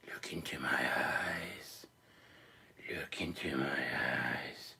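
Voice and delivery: slow, low hypnotist whisper; a doll entrancing the captain in a slow low whisper; hypnotic whisper. Pretend.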